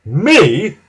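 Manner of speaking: loud, indignant snort; Gordon. A narrator's reading. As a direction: snort it out in disbelief. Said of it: "snorted Gordon".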